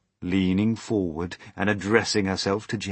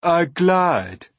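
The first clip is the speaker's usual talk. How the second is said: high, loud, prim correction; prim earthworm; 11 semitones higher, 11 dB louder than usual. A narrator's reading. Earthworm correcting the centipede, prim. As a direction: pronounce it primly, pitched up. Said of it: "said the earthworm primly".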